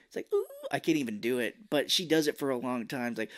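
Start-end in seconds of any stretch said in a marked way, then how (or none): 0.3-0.5 imitated thin high whine; high-pitched whine. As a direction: attempt a squealed whine. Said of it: "like a high-pitched whine".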